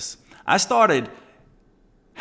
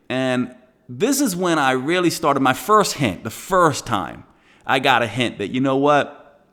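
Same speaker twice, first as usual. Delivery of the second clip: ordinary talk, nothing marked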